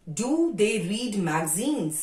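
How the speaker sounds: very slow question, every word apart; careful teacher's model question; slow dictation. Shown as a demonstration. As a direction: read the question out for the class to copy. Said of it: "teacher dictating a question".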